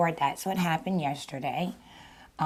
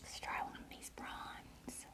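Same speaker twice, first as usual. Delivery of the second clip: soft whisper to herself; whispered aside; 20 dB quieter than usual. Real, not acted. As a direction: murmur it under your breath. Whispered aside while eating.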